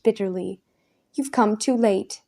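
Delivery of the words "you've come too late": usual speaking voice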